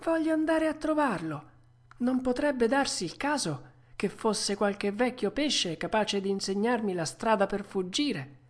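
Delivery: put-on high young puppet voice; hopeful puppet. Puppet hopes an old fish knows the way out, high hopeful voice. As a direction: pitch up the eager question.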